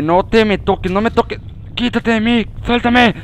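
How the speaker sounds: building from loud pleas to a shrill final scream; screaming in terror, climbing to a shriek; panicked screaming. Real, not acted. Spider grabs his character.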